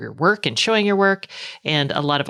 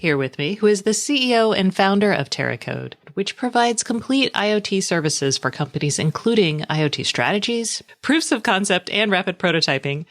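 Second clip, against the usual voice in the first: upbeat, higher-pitched podcast ad read; sponsor read; 5 semitones higher than usual. Real, not acted. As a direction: introduce the sponsor like a polished host. Reads a sponsor message.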